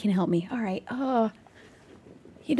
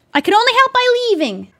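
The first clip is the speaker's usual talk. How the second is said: higher, louder protest; high pleading defense; 10 semitones higher, 5 dB louder than usual. Acted. Player defending her leaving to the spirit, high and pleading. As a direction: insist desperately.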